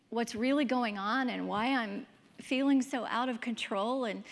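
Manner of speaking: normal talking voice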